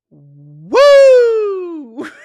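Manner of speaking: long, high shouted woo; soaring "woo" at full volume; loud whoop. Real, not acted. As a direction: let out a big drawn-out whoop. Exclaims after finishing the recap.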